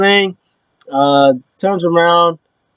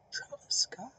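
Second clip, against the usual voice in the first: breathy whispered disbelief; incredulous whisper; 22 dB quieter than usual. Real, not acted. Fan stunned that [name] lost to [name], whispered.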